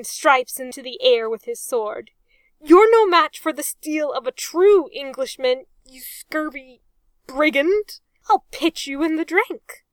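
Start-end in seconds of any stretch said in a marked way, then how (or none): 2.6-9.8 loud, raised, swashbuckling taunt; blustering challenge. A narrator's reading. Arthur challenging Reg to swordfight, loud bluster. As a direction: throw the insult with bravado.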